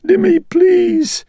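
high, loud, tearful pleading; frightened and tearful. A narrator's reading. Mother begging her son not to cast her out, sobbing. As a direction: cry out to him, terrified of the dark. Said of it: "grew frightened and tearful".

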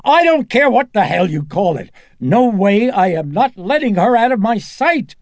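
mother shouting down the doctors; furious refusal. Acted. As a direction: refuse them with everything you have.

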